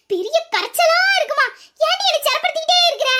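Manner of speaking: high-pitched fed-up complaint; worn-out, high-pitched nagging; exasperated scolding.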